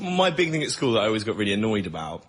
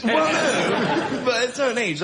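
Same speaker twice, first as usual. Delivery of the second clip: laughing protest; 10 semitones higher, 3 dB louder than usual. Real, not acted. Laughing reply, high.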